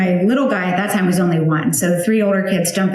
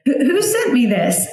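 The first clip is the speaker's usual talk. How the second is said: high, baffled mock-old-lady voice; puzzled high squeak, half-teasing; confused; 5 semitones higher than usual. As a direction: act out a confused old neighbor. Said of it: "the overall sentiment is just confusion".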